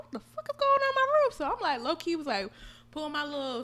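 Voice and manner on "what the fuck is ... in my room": quick, high-pitched startled self; rushed mock-startled question; retold alarm. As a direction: act out mild bewildered alarm, pitched up. Retelling waking up to noises.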